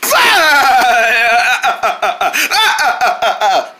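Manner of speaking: long high cackle breaking into ha-ha bursts; acted laughing fit. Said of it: "What's so funny?".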